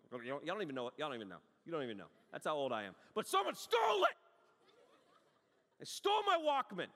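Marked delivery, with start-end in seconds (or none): 3.1-6.9 shouted, much higher and louder; outraged shout. Retold or imitated. Preacher shouting to the congregation about the theft. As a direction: yell it out like it just happened.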